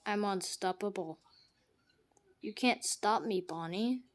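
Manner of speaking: ordinary delivery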